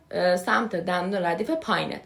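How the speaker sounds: ordinary talk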